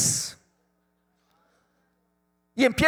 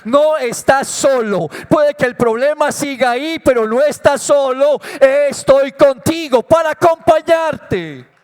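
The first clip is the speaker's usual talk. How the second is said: louder, proclaiming God's comforting words, slowing at the end; God's promise, preached; 2 semitones higher, 5 dB louder than usual. Acted. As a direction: call it out as God's reassurance. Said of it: "Así que está diciendo". (promesa de Dios, proclamada con fuerza)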